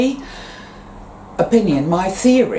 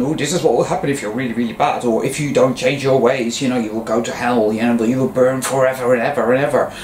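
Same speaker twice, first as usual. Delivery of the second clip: lower, fast, ominous warning voice; mock hellfire warning; 5 semitones lower, faster than usual. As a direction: rattle off the threat of hell. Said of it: "they're shown hell".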